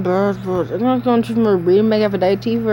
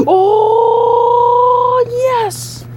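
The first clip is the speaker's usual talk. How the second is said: excited cheer; 13 semitones higher than usual. Real, not acted. Cheering a lucky hit.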